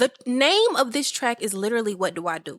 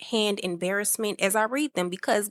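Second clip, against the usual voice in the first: ordinary talk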